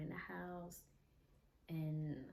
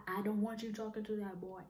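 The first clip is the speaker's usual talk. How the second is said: higher, firm aggressive order; 4 semitones higher, 4 dB louder, faster than usual. Retold or imitated. Jealous boyfriend forbidding her friend, imitated aggressive demand. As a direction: imitate him laying down the law, raised pitch. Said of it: "He said it in an aggressively way".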